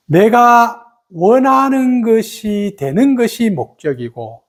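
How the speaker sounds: normal talking voice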